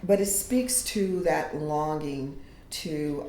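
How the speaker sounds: ordinary talk, nothing marked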